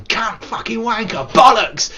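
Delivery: high, mock sweary outburst; imitating own ranting; sweary torrent. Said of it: "a torrent of me going".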